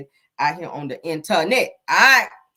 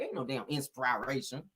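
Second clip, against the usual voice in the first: usual speaking voice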